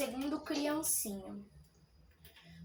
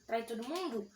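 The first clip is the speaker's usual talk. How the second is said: ordinary talk, nothing marked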